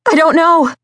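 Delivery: high, loud, strangled panic; strangled cry. A narrator's reading. Lost captive answering the men searching for her, strangled cry. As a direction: cry it out helplessly from far away. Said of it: "Her strangled voice faded into the night".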